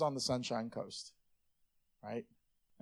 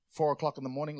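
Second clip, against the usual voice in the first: ordinary talk, nothing marked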